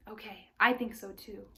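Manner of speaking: ordinary delivery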